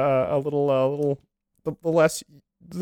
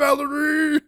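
villain yell; 12 semitones higher, slower than usual. Pretend.

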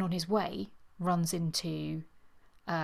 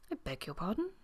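softer, mildly puzzled question; blank astonishment; 2 semitones higher, 7 dB quieter, faster than usual. A narrator's reading. Astonished man after her outburst, blank question. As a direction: ask it blankly, quietly taken aback. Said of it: "he said blankly".

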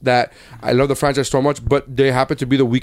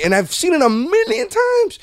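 excited exaggeration. Real, not acted. Fan insisting on how often he's watched Goodfellas, excited. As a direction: voice soaring very high. Gushing about a favorite movie.

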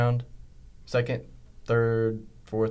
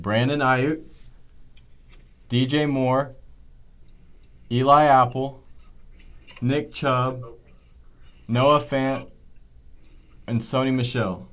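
slow list, long pause after each name; slower than usual. Shown as a demonstration. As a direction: read each name slowly, pausing between them. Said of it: "say that again, but slow".

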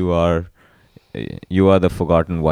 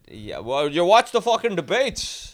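mimicking self; 11 semitones higher, 3 dB quieter than usual. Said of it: "They're just loud talk".

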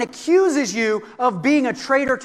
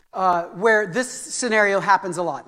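ordinary talk

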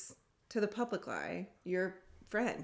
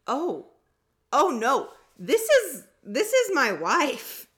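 loud, high, hammed-up protest; exaggerated indignant correction; 8 semitones higher, 10 dB louder, slower than usual. Wife corrects a stranger in line, hammed-up indignation. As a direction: overdramatize it, stretching the last words out loud. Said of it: "She didn't say it like that".

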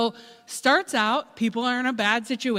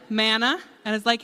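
usual speaking voice

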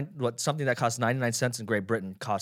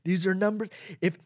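Donald Trump impression; 7 semitones higher, slower than usual. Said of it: "I can't do the fucking Donald Trump, uh, uh, thing very well".

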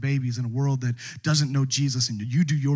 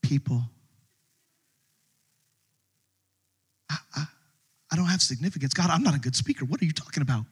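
timid doubter. Retold or imitated. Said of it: "you go out to the world and you're like".

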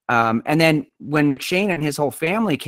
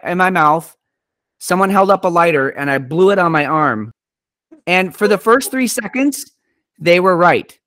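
ordinary talk

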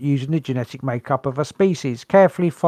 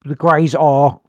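ordinary delivery

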